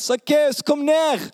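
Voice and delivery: high-pitched, urgent call; calling out by name. Acted. Zacchaeus retelling how Jesus called him down, high call. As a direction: call it up high to someone overhead. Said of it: "men han ropte på navnet mitt".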